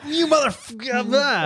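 high, garbled angry caller; mock cursing rant. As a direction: squeal out a hammed-up curse. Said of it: "It's somebody like".

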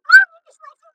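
mock a know-it-all, high and loud; mocking quote.

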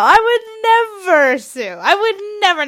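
high, emphatic protest; hammed-up backpedaling; innocent denial. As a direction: swear innocence with mock horror. Denies threatening to sue.

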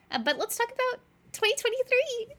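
bright, high-pitched eager kickoff; high, eager excitement; excited. Real, not acted. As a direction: lift way up with real excitement to start the new topic. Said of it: "I'm so excited".

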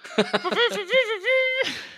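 high buzzing kazoo noise; kazoo imitation. Player plays the microcone like a kazoo, high imitated buzz. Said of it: "starts playing it like a kazoo".

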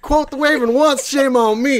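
Deep South Poe. Acted. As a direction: play Poe as a Southern good old boy. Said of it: "impression of Edgar Allan Poe if he was from the Deep South".

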